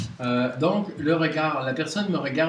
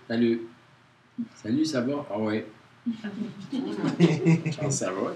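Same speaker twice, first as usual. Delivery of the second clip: flat, dry, uninterested greeting; curt dry voice; slower than usual. Shown as a demonstration. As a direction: answer coldly, as if you'd rather leave. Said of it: "L'autre, c'est sec". (voix sèche et dure, sans intérêt)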